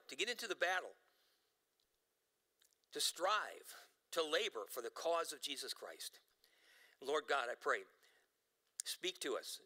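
low, measured pastoral prayer; earnest prayer. Acted. Pastor praying for the church before the sermon, earnest prayer. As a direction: pray over the congregation, unhurried.

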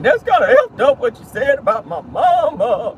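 high whiny hammed-up crying voice. Mocking [name]'s tearful reunion complaint, high whiny fake sob. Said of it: "went into that whole crying thing".

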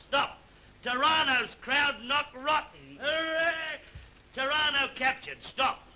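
high, clipped-free telegram read with each Stop marked; telegram read aloud. Acted. King reads the general's telegram aloud to courtiers, announcing. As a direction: read the wire to the court, word by word.

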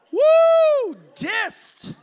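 high whooping holler; big theatrical burn shout; mock crowing. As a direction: whoop it out loud like a crowd reacting to an insult. Crowing over his own rejection.

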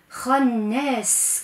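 خناس with the final sin stretched long; long hissing s at the end of خناس; held sin. Shown as a demonstration. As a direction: draw out the s in خناس. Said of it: "كيف أنا وقفت عليها".

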